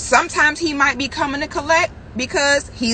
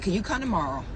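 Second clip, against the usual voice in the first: small, softer voice for the other woman; soft pleading imitation; 4 semitones lower, 8 dB quieter, much faster than usual. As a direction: drop low and coax, imitating her.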